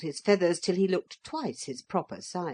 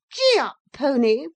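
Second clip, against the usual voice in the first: high loud call to the steed; bossy command; 9 semitones higher, 8 dB louder than usual. A narrator's reading. Motor lady to the hippogriff, loud command. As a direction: order the beast forward.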